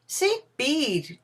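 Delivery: high exclamation of a name; declaring in recognition. A narrator's reading. Scrooge recognises his next visitor, high declaration. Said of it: "declared Scrooge".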